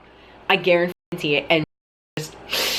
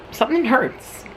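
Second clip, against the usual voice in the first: pained realisation; 7 semitones higher than usual. Retold or imitated. Acts out noticing the neck pain.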